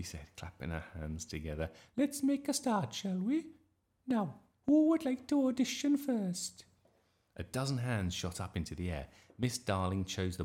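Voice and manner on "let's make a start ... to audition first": high, loud sing-out teacher voice; fussy drama teacher. A narrator's reading. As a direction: call the class to order, high-pitched. Voices teacher Miss Darling.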